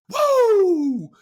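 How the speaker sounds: loud, high celebratory woo; excited whoop. Real, not acted. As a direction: let out a big drawn-out whoop. Celebrating anime season's return.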